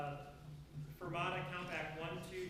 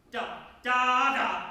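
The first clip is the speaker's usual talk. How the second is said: very loud, high da hits; popped beats; 6 semitones higher, 15 dB louder, much slower than usual. Shown as a demonstration. Conductor shows the brass hits, each beat popped out loudly. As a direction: pop each da out hard. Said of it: "pop each beat out".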